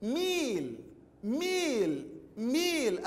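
ميل with a long, falling ii; long ii vowel. Shown as a demonstration. Teacher modelling the word for pupils, stretched ii. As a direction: hold the vowel after the miim in ميل. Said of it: "نلاحظ حرف الميم مكسور".

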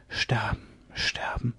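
very soft, fading to voiceless breath; whisper. A narrator's reading. Captive girl wishing for death to herself, whispering. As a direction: whisper it as a despairing wish. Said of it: "flüsterte Myga van Bergen".

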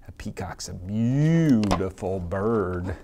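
usual speaking voice

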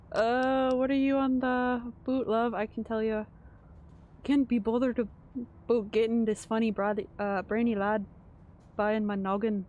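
a loose British-Scottish put-on accent; half-hearted attempt at a Scottish brogue; improvised Scottish-ish accent. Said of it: "that was probably not meant to be Scottish".

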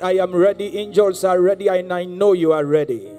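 ordinary talk

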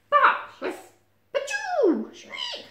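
very high, explosive yelps; cartoon animals attempting badger noises; animal barks and shrieks. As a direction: burst out in silly squealing barks. Animals make their badger barks.

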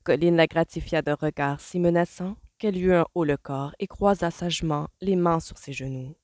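usual speaking voice

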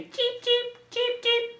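very high, short cheeps; little chick. Acted. Parent showing what little chicks say, high cheeping. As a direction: peep high like a baby chick. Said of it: "The little chicks say".

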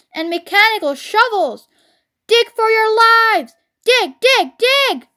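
urgent orders climbing high and loud; shout. A narrator's reading. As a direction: shout for their lives, building to a peak. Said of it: "shouted Mister Fox".

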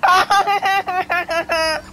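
high cackling laugh like a witch; witch cackle. Real, not acted. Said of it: "you sound like a witch".

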